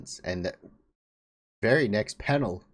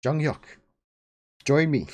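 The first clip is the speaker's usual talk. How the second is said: raised, louder call from the head; a raised, louder summons from the head; severed head calling out; 6 semitones higher, 6 dB louder than usual. Acted. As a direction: beckon him in as the severed head. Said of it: "the head calls out to Jung Hyuk".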